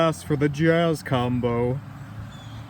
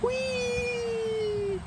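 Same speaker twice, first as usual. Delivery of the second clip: long high joyful cry; joyful whee; 8 dB quieter than usual. Acted. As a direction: sing out on the ride. Riding the bike happily.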